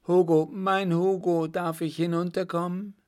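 high-pitched joyful woman's call; Margot. A narrator's reading. Woman calling from the gable window to her lover, eager call. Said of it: "die Stimme Margots ertönte".

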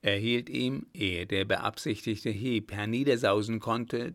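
plain reading voice

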